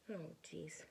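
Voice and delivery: ordinary delivery, nothing marked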